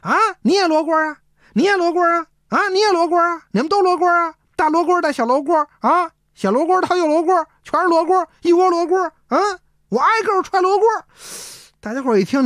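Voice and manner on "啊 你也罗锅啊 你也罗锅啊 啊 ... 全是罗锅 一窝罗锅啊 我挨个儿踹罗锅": ranting curses. Acted. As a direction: rant at everyone like a madman. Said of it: "骂得更凶了". (疯狂地高声骂人)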